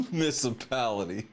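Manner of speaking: low word squeezed between laughs; laughing. Real, not acted. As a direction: laugh while repeating the word.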